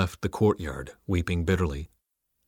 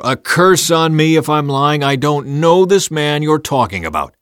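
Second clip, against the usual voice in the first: loud, higher, vehement denial; an oath sworn in a cornered panic; swearing an oath; 6 semitones higher, 9 dB louder than usual. A narrator's reading. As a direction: swear it forcefully, denying everything. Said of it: "Peter swore".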